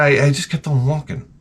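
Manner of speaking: normal talking voice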